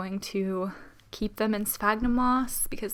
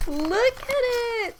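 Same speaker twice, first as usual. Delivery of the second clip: much higher, slow, drawn-out delight; delighted gush; 13 semitones higher, much slower than usual. Real, not acted. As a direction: marvel at it, stretching the words. Admiring the new plant.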